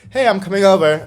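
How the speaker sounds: loud, high mocking quote; exaggerated mock announcement; mock self-invitation. Mock quote of what she never says.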